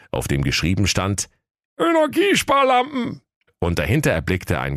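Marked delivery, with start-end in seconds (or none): 1.8-3.2 put-on high cheeky boy voice; bratty teenage boy, much higher; cheeky young lad. Aladdin reads the sign.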